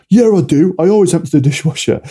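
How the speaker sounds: protest, stung and defensive; indignant denial. Retold or imitated. Partner denying the dishwasher charge, indignant and loud. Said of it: "you're just clashing".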